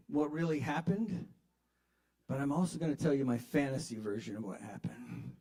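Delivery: ordinary delivery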